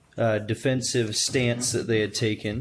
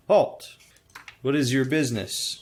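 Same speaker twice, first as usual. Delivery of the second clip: gruff shout; 3 semitones higher than usual. Acted. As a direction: bark it like a gate guard. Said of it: "a gruff voice shout".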